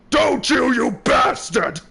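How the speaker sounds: a hammed-up courtroom outburst; angry shout. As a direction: hurl the insult upward. Accusing the attorney in courtroom.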